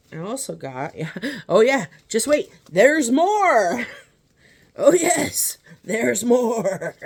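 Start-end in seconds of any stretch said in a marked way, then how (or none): 1.5-3.9 loud, high teasing announcement; like a hammed-up infomercial; excited. 4.8-7.0 loud, high teasing announcement; like a hammed-up infomercial; excited. Teasing buildup, louder still.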